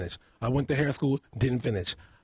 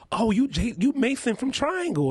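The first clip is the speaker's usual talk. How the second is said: high, excited fan voice; gushing starstruck fan; 10 semitones higher than usual. Retold or imitated. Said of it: "people walk up to me and they're like".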